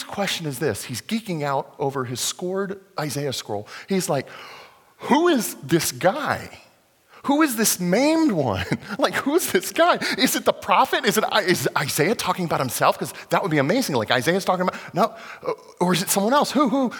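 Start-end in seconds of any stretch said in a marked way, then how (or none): 5.0-16.9 raised, eager voice piling up questions; excited eunuch. Acted. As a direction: wonder at the scroll, half laughing. Eunuch voiced excitedly reading scroll.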